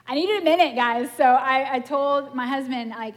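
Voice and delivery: normal talking voice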